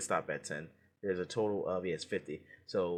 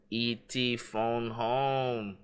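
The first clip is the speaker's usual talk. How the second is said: 1 E.T. voice; much slower than usual. Acted. Quoting E.T. in quiz.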